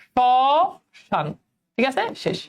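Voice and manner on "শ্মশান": "শ্মশান" very slowly as sho-shan, no m sound; pronunciation demo. Shown as a demonstration. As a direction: hold a long sho, pause, then finish "শ্মশান" with shan. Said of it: "উচ্চারণ কি হবে বলো".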